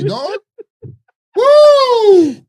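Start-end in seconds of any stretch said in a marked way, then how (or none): 1.4-2.4 loud, high whooping yell; loud, high Woo. Real, not acted. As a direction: let out an amazed whoop. Reacting to curry story.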